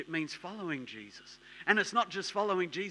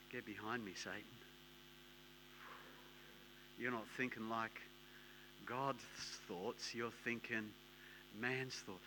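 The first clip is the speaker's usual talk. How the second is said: quiet rebuke; 7 semitones lower, 13 dB quieter than usual. Acted. Jesus rebukes Peter.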